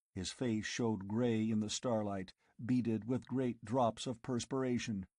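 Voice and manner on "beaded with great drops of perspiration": usual speaking voice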